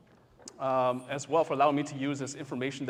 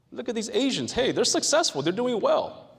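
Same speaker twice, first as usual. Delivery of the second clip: mock cheerful bystander; 8 semitones higher, 4 dB louder than usual. Teacher mimicking outsiders praising successful Asians, mock cheerful. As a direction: imitate someone gushing over rich Asians. Mocking the model minority view.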